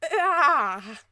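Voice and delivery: loud, high "ah" of discomfort; exasperated cry. Real, not acted. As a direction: cry out in mock distress. Groans at the quoted num-num.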